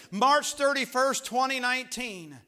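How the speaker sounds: high, slow, ringing announcement of the date; preacher announcing a great day; loud proclamation. Real, not acted. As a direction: proclaim it at full pulpit volume.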